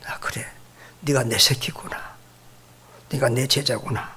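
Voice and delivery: quiet, hushed inner voice; soft inner thought. Retold or imitated. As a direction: murmur it to yourself, moved. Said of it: "이런 생각이 들었습니다". (속으로 하는 조용한 혼잣말)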